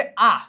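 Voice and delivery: loud, open long vowel આ; A sounded as આ. Shown as a demonstration. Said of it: "આ ઉચ્ચાર કરવાનો છે આપણે". (આ નો લાંબો, ભારપૂર્વક ઉચ્ચાર)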